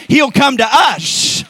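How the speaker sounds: high, loud, drawn-out proclamation; preaching shout. Real, not acted. Preacher promising the church God will come, exultant shout.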